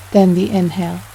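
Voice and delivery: ordinary delivery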